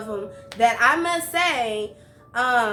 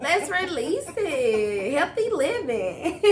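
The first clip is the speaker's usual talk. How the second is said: slow, stretched words with swooping pitch; drawn-out singsong encouragement; playful urging; 3 semitones higher, slower than usual. Acted. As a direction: draw it out playfully, breaking toward a laugh. Host playfully urging stress relief.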